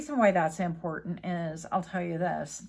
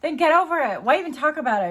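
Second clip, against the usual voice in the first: much higher and louder, exasperated; heated outburst; 7 semitones higher, 8 dB louder, faster than usual. Real, not acted. Venting about cheaters, loud and exasperated. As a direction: blurt it out, fed up. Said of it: "very hot topic for me".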